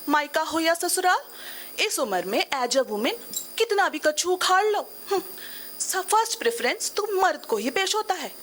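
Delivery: theatrical middle-aged woman, lively and rueful; middle-aged woman monologue. Acted. Woman confides her midlife confusion to the audience, theatrical storytelling. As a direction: tell it as a stage monologue, with dramatic pauses.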